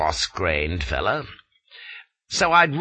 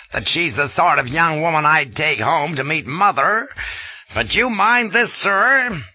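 louder, higher indignant lecture; 9 semitones higher, 5 dB louder than usual. A narrator's reading. Colonel defending the Maharani to Flashman, indignant. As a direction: defend her honour, offended.

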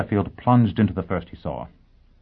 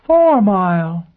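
high, loud, drawn-out cry; astonished exclamation; 11 semitones higher, 8 dB louder, slower than usual. A narrator's reading. Assistant recognizing the famous visitor, exclaiming. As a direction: cry the name out slowly in amazement. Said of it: "he exclaimed".

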